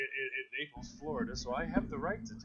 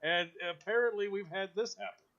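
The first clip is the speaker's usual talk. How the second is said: high mocking skeptic; 8 semitones higher than usual. Retold skeptic argues.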